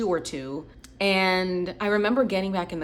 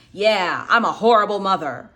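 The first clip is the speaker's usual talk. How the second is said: louder, bitter mock mother; mother to upset daughter, sarcastic and loud; sarcastic self-pity; 7 dB louder than usual. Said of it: "She's like".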